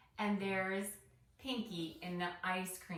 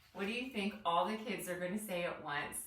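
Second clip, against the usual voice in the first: ordinary delivery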